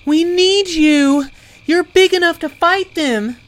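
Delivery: very high, loud urgent plea; desperate shouting. A narrator's reading. Zelly begs the owlbear to fight wolves, desperate shouting. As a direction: shout a plea for help at full voice. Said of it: "Zelly cried".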